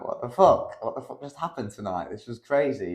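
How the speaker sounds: wide-eyed wonder, acted out; retold disbelief. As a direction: replay being amazed together. Retold stunned reaction after the wins.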